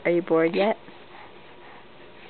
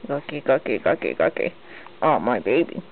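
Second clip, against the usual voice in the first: very low, growly nonsense chant; deep playful coo; 10 semitones lower than usual. Real, not acted. Mother playing with her baby, deep nonsense chant. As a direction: drop way down and play.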